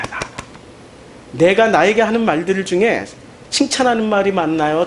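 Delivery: ordinary talk, nothing marked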